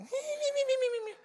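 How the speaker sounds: very high squeaky giggling "me, me, me"; chipmunk laugh. Retold or imitated. Pastor imitating his wife's holy laughter, very high chipmunk giggle. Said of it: "my wife sounded like a chipmunk".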